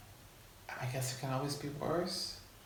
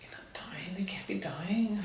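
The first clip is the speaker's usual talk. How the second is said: whispered disbelief; 6 semitones higher than usual. Retold or imitated. Denial to dying partner retold.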